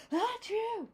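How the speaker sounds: a big loud achoo, high and sudden; sneeze. Acted. Papa sneezing mid-boast on the dusty road, loud achoo. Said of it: "That was quite a sneeze".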